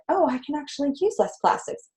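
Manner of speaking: high, soft dawning discovery; softly pleased mock discovery; acted realisation. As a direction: realise it lightly, as someone else. Voices someone's pleasant realisation.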